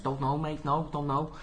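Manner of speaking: a light mock Scouse accent. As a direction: mumble it like a Liverpool local. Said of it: "I put some mock Scouse accent on".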